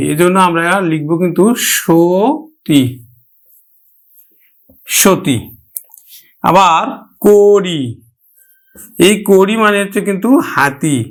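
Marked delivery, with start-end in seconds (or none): 1.8-5.4 সতী with an sh sound, drawn out first; palatal sh. Shown as a demonstration. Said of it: "তালব্য স উচ্চারণ করি". 7.3-7.8 কড়ি with a rounded first o, "kori"; closed o. Shown as a demonstration. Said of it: "সংবৃত উচ্চারণে".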